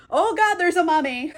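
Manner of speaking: hammed-up fright; mock alarm. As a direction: act out a startled realization. Julie startled by the mummy.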